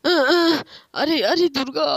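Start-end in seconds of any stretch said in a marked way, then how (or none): 0.0-0.6 loud, high shout to wake someone; loud yell. Acted. Child yells at the snoring father to wake him, loud and high. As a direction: yell right in the sleeper's ear.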